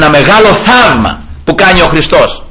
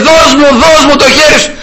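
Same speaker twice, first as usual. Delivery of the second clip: shouting; 11 semitones higher than usual. Retold or imitated. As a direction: yell it at the drowning man. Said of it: "του φώναζε".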